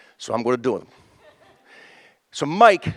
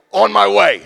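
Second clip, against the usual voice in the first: loud, raised mock-official answer; imitated booming reply; 4 semitones higher, 8 dB louder, slower than usual. Mistaken security guard answers the alarm, booming. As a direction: call it out like a guard taking charge.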